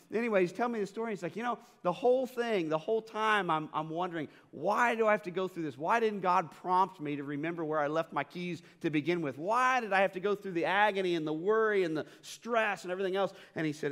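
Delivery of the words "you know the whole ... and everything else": exasperated retelling. Retold or imitated. Retold exasperated complaint to God.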